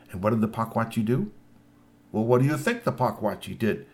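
ordinary delivery, nothing marked

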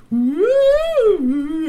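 loud high hooting woo; Sasquatch call. A narrator's reading. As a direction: hoot like a wild creature.

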